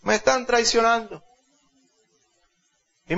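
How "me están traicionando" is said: high, hammed-up cry of treason; hammed-up high shriek of treason; shouting treason. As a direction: wail it like a queen caught out. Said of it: "gritó".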